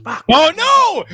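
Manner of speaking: normal talking voice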